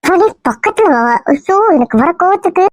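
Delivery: a lightly put-on girl's voice, hurt and coy; woman's voice. Disguised man plays the girl wooed by the husband. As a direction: play the wronged young woman, only lightly feminine. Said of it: "இன்னும் பொம்பளை குரல்ல தான் பேசுற".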